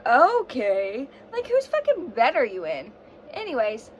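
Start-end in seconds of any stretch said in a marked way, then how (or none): none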